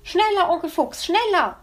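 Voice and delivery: put-on demanding child. Weißohr urging Uncle Fox to speed up, high and eager. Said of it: "forderte er".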